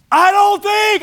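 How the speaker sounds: high yell into the wind; shouting over wind. Pretend. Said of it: "I'm yelling 'cause the wind is so strong".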